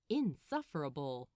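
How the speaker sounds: ordinary delivery, nothing marked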